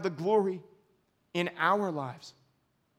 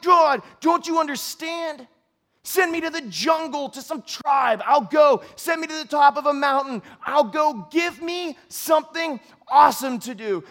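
loud, high protest at God; angry plea; 6 semitones higher, 5 dB louder than usual. Acted. Pastor shouting his frustration at God, angry and loud. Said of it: "And I'm, like, angry".

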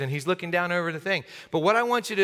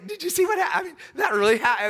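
much higher, giddy with amazement; excited disciple; 10 semitones higher, faster than usual. Pretend. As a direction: blurt it out, thrilled and laughing.

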